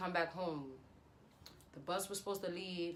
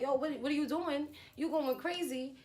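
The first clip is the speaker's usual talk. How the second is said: high-pitched retold bewilderment; incredulous challenge; 8 semitones higher than usual. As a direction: challenge his behaviour in disbelief. Retelling her confronting him.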